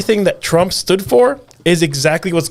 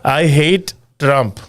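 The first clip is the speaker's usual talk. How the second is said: put-on Indian accent; 2 semitones lower than usual. Quoting Indian stockbroker friend to podcast hosts, Indian accent. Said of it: "I'm gonna say it in the accent that he said it".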